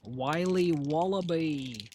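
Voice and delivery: put-on terrible Australian accent. Streamer joking about licorice brand, terrible Australian accent. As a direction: stretch the brand name in a badly faked Australian accent. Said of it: "that's my terrible Australian accent".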